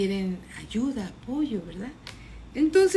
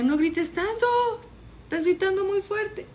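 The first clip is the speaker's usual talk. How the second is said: high-pitched, lightly voiced telling-off; mild acted scolding; 10 semitones higher than usual. Crowd tells Bartimeo to stop shouting, mildly scolding. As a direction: hush a noisy man with mock reproach.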